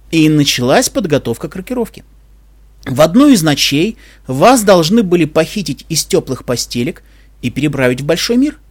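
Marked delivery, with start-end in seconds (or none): none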